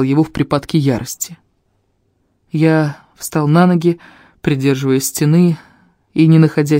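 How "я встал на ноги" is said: usual speaking voice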